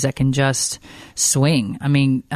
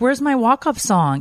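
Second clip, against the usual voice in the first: put-on higher, drawn-out kid-style question; child's demand; 9 semitones higher than usual. Mom imitating her son asking about his song, childlike plea. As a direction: lightly voice a kid asking, slowly.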